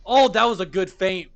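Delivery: high, loud commentator praise; impressed outburst. Real, not acted. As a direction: exclaim it, thrilled by the play. Reacts to a feint live.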